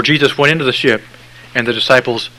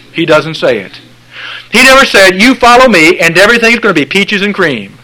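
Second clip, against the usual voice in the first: loud, high-pitched pulpit preaching; full-force preaching; 6 semitones higher, 6 dB louder than usual. Acted. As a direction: drive it home to the congregation.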